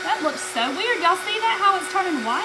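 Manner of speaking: surprised. Real, not acted.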